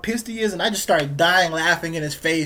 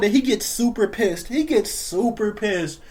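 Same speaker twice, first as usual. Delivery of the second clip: ordinary talk, nothing marked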